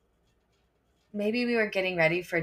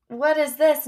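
higher, louder puzzled question; surprised question; 8 semitones higher, 6 dB louder than usual. Retold or imitated. Teacher holding a heavy shoe box, surprised and raised. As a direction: ask it, caught off guard.

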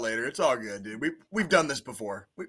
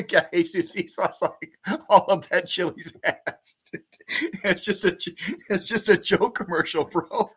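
laughing; 6 semitones higher than usual. Real, not acted. Laughing through the words.

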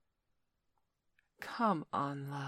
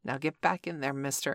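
ordinary talk, nothing marked

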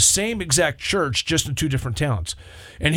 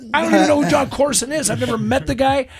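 much higher, louder exasperated denial; indignant protest; 11 semitones higher, 4 dB louder than usual. Retold or imitated. Retelling his protest to the people accusing him, exasperated. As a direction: throw up your hands and insist. Protesting being called a copycat.

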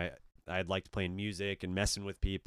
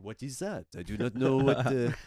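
puzzled Frenchman, put-on accent; French character; 3 semitones higher than usual. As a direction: play clueless in a French accent. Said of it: "like, a French character".

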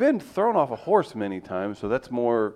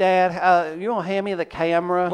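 put-on Southern accent; 7 semitones higher, 3 dB louder than usual. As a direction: lay on the molasses. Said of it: "with a little bit, little molasses in it".

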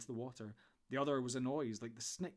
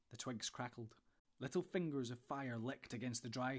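ordinary delivery, nothing marked

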